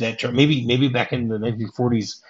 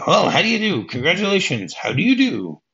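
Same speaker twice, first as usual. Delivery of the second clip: high, swooping mock-flustered congratulations; hammed-up eager hello; acted delighted greeting; 7 semitones higher than usual. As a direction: act out greeting a surprise new in-law. Acting out greeting a new wife.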